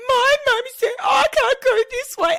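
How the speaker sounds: put-on high, loud wailing little boy voice; crying toddler. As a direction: wail like a toddler clinging to a stranger.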